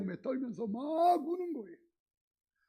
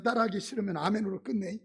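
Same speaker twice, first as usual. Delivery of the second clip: ordinary delivery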